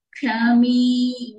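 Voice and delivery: క్షమి with the last vowel held long; long final mi. Shown as a demonstration. Teacher shows long ending, క్షమి with drawn-out mi. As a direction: stretch the ending of క్షమి slowly. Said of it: "మీ దీర్ఘంగా పలకాలండి".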